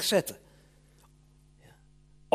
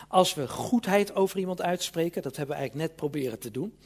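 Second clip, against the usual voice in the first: usual speaking voice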